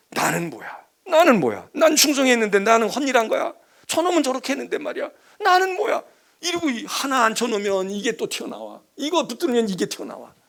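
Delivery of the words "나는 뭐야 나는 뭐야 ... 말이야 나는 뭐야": louder, rising to a high cry; indignant outburst. Acted. Elder son's jealous protest, building to a high cry. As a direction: demand it, building up.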